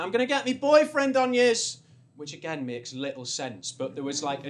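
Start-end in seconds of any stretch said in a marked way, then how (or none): 0.0-1.8 loud, high mock drunk threat; mock yelled drunk threat; retold shout. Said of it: "she'd shouted something like".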